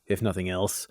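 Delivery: ordinary delivery, nothing marked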